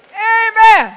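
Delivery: a big shouted amen from the crowd; loud amen. Real, not acted. Congregation shouting amen back to the preacher.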